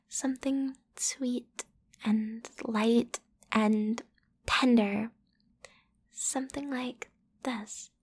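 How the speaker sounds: soft breathy whisper, slowing down; intimate whisper. Acted. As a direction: lean in close and whisper it. Teaching a kiss, intimate whisper.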